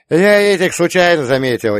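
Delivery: put-on lively high old man; excited old-timer telling a tale; old prospector. A narrator's reading. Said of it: "излагал он, оживясь". (оживлённый старик)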